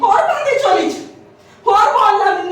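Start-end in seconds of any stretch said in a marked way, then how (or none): 0.0-0.9 sarcastic retort. Acted. Sister-in-law mocks the younger wife.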